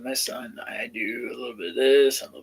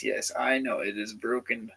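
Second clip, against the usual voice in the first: normal talking voice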